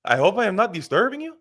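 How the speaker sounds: high, eager, overly polite campaign caller; mock the nervous candidate asking for votes, pitched high. Candidate phoning a union member for a vote, high and polite. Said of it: "he would be like".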